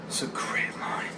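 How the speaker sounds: real hushed whisper; whispered aside. Real, not acted. Fan whispering his awe over the Star Wars line. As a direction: breathe it reverently like a secret.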